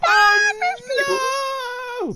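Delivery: high, loud wail of alarm; panicked scream. Real, not acted. Player caught in a sudden fight, panicked scream. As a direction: cry out in real game panic.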